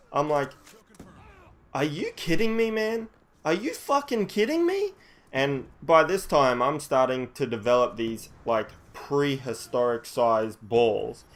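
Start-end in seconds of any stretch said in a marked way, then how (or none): 1.7-4.9 high-pitched outraged disbelief; incredulous. Retold or imitated. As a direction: pitch it way up, aghast at the accusation. Retells outraged reply to accusation.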